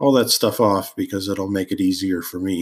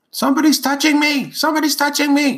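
put-on high-pitched whiny kid's complaint, a bit louder; 5 dB louder, faster than usual. Siblings crammed in the back seat, high whiny kid complaint.

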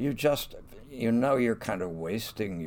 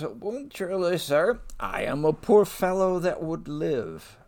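a hint of a raised whiny rogue, slowed; lightly voiced Pompey; 9 semitones higher, 4 dB louder than usual. Rogue Pompey excuses himself to the judge, mildly whiny. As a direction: play the poor fellow, mildly pleading.

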